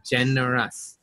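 Generous starting with a J sound; soft G. Shown as a demonstration. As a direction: say Generous softly, opening like "jen". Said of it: "Like a J sound".

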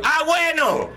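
high, meek okay; timid boy reply. Retold or imitated. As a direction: agree like a cowed kid. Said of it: "Y yo".

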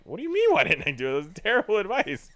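high, loud, fast protest; mock incredulous. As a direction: protest in disbelief, half laughing. Acts his protest to boss.

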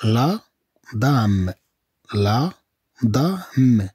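"لا" and "دام" said separately; measured teacher's sounding-out; syllable by syllable. Shown as a demonstration. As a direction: pause after each syllable of "لا دام". Teacher splits word into syllables.

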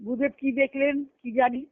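ordinary delivery

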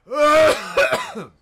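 harsh, loud coughing; loud hacking cough. Real, not acted. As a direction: choke out a cough. Coughing fit continues.

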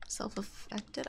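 whispered. Real, not acted. Reading a clue to himself.

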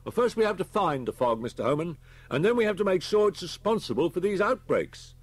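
high, loud, emphatic officer voice; policeman insisting on procedure. A narrator's reading. Policeman answers Homan's protest, high and insistent. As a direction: explain it forcefully to an objector.

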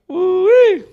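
high, loud joyful woo-wee; ecstatic whoop. Real, not acted. Host whooping at the pastor's word, high and loud. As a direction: let it burst out of you. Overwhelmed by the sermon.

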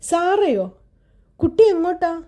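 sugary voice masking irritation; forced smile. A narrator's reading. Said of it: "ചിരിക്കാൻ ശ്രമിച്ചുകൊണ്ട് ചോദിച്ചു". (ദേഷ്യം മറച്ച് ചിരിച്ചുകൊണ്ടുള്ള ഉയർന്ന സ്വരം)

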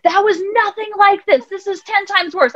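put-on high, slow protesting kid voice; a kid insisting it's far worse; upset child. Son protests, voiced by mother.

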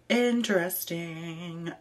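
Interesting stretched out slowly, dry and knowing; slow drawn-out interesting. Real, not acted. Reading a fetish request, drawn-out amused interesting.

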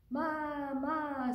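high, drawn-out storybook goat; goat bleat. A narrator's reading. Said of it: "said the goat".

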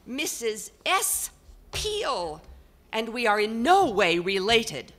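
high, loud, exasperated portrait voice; exasperated bellow. Acted. Portrait on the wall bellows at the family, exasperated. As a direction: bellow it out, fed up with ignorant people. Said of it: "a voice that could only be described as exasperated, practically a bellow".

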